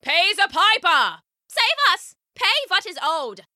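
high, urgent cries from a crowd; villagers calling out. A narrator's reading. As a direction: call up at the leaders, pitched high. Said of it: "started to call out".

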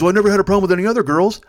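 loud, fast defensive whine; defensive drunk friend. Retold or imitated. Drunk friend defending his past relationships, loud fast defensive whine. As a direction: brush off the problem.

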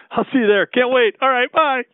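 usual speaking voice

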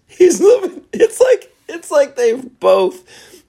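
squeaky laughing, very high and loud; cracking up mid-sentence; laughing disbelief. Real, not acted. Vlogger laughing at the trailer plot, very high.

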